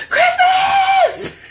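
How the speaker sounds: high squealed shout of joy; sudden high shout of Christmas glee; excited shriek. Retold or imitated. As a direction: shriek the word like a giddy fan. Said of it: "The cat about flew off the couch".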